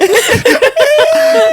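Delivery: squealing laugh; high burst of laughter. Real, not acted. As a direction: laugh it out loud. Laughing at own story.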